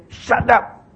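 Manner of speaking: sharp high command; commanding rebuke. Retold or imitated. Pastor telling Hagin's story, Hagin rebukes demon, high sharp command. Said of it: "and he said".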